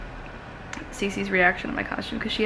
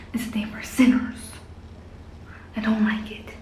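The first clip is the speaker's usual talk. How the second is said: hushed character whisper; whispering while hiding; 3 dB quieter than usual. Pretend. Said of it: "So I'm hiding in my bathroom".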